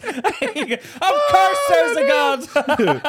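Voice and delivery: loud, high, drawn-out cry through laughter; mock-awed exclamation. Co-host joking that granddad's anatomy proves God, mock-awed laughing cry. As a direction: belt it out slowly, laughing in mock awe.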